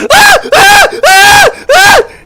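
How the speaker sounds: very high, loud screams in short bursts; repeated mock scream. Barry opens the wardrobe to scare everyone, repeated high shriek. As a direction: shriek it out four times. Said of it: "lets out a fucking scream".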